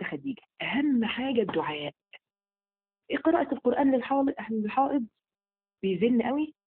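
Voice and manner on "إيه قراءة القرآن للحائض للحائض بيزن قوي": surprised question. Real, not acted. Reading out a viewer's question.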